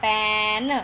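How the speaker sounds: normal talking voice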